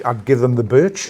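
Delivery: put-on light Northumbrian pit-town accent. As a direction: quote the old man in broad Northumbrian. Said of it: "it's the way he says it".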